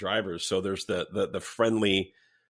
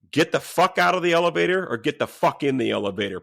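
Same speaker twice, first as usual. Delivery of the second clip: raised, higher, forceful retelling; retold angry order; 6 semitones higher, 5 dB louder than usual. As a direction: lay down a profane ultimatum, reenacted. Retelling his order to the bully.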